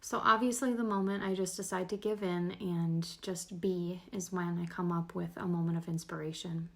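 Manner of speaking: normal talking voice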